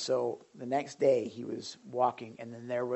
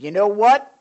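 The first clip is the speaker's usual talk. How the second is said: raised, defiant question; loud challenge; 8 semitones higher, 13 dB louder than usual. Retold or imitated. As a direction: call it out boldly. Preacher retelling prison confrontation.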